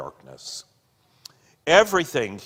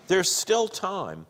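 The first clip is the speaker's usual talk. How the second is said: urging; 9 semitones higher, slower than usual. A narrator's reading. Lady urges him to stop.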